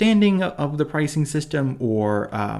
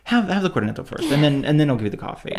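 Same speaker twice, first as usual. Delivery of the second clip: playful mock barista, higher pitched; bossy barista; 8 semitones higher than usual. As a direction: make it sound like the customer's own idea. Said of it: "they'll even act like it's your idea".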